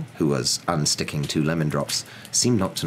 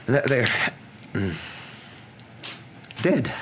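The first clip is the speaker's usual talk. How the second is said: faltering; 8 semitones higher than usual. Acted. Professor struggles to report deaths.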